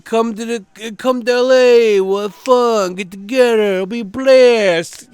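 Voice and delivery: high, loud mock movie-hero voice; hammed-up impression, pushed high and loud; Bruce Willis. Quoting an invitation to L A, hammed-up impression. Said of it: "Yeah, Bruce Willis".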